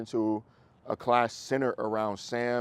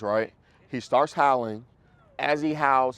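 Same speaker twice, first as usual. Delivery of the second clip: normal talking voice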